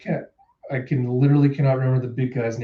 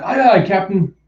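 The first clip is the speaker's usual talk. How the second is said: higher-pitched, ready answer; eager crew reply; 6 semitones higher, 4 dB louder than usual. Acted. Crew answering their captain before surfacing, eager and higher.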